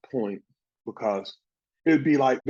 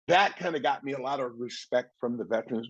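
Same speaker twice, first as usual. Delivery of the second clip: ordinary talk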